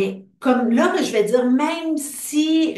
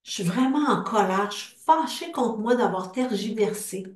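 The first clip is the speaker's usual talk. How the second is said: guided tapping script. Acted. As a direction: lead the group through each feeling, line by line. Leads an EFT tapping script.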